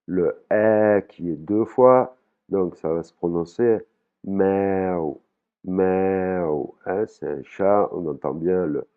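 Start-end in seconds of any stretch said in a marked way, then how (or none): 4.2-6.7 « miaou » avec la voyelle tenue longtemps; étire la voyelle de « miaou »; voyelle longue thaïe. Shown as a demonstration. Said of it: "le son E qui est long". (prononciation thaïe, voyelle longue)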